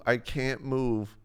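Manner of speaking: slow, dazed drag on I can't move; slow dazed repeat. Retold or imitated. Girlfriend stuck in her seat, slow and dazed. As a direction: let I can't move crawl out.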